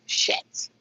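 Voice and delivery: voiceless, under-the-breath shit; a disappointed hushed swear at the shelf; whispered curse. Real, not acted. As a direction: swear it to yourself, barely audible. Chips sold out, mutters curse.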